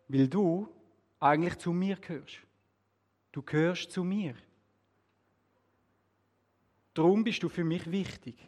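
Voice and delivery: measured phrases with long pauses; very slow. A narrator's reading. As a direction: say it unhurriedly, letting each phrase settle. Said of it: "sagt ganz langsam". (ganz langsam, mit langen Pausen)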